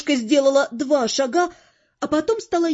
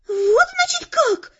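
very high, squealing indignation; outraged shriek; slower than usual. A narrator's reading. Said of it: "взвизгнула девица". (возмущённо взвизгнуть)

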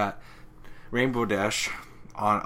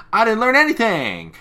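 much higher and louder; hammed-up squeal; squealing outburst; 12 semitones higher, 12 dB louder than usual. Twilight quote peaks.

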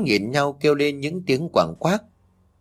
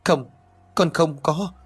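a lightly voiced scared young mother, voice pitched higher; pleading that a ghost did it; frightened denial; 6 semitones higher than usual. A narrator's reading. Daughter-in-law denies, blames ghost. (con dâu sợ hãi chối với mẹ chồng, giọng cao hơn)

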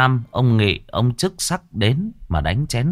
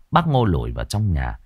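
ordinary delivery

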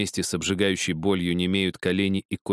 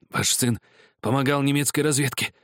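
ordinary delivery

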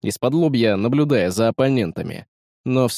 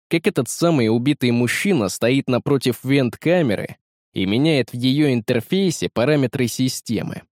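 usual speaking voice

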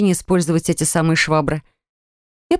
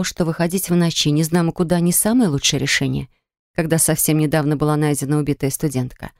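usual speaking voice

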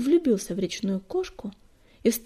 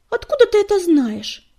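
high, loud indignant challenge; 8 semitones higher, 8 dB louder than usual. A narrator's reading. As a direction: demand it in outrage, pitch falling. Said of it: "возмущённо зашипела Белоснежка".

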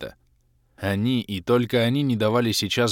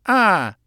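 loud high open-mouthed aah; 12 semitones higher, 8 dB louder than usual. Acted. Kalen opening mouth for a potion, loud ah.

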